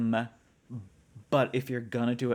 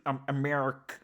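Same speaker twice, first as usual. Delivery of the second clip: mock American, higher than usual; overdone American accent; 4 semitones higher than usual. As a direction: lay the American accent on too thick. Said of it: "I do it too much American".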